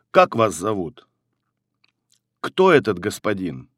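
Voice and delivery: usual speaking voice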